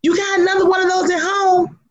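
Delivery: high, sassy repeat, leaning on home; grandma's taunt again. Retold or imitated. Grandma repeating her taunt to the biker: high sassy teasing. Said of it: "grandma repeats the taunt".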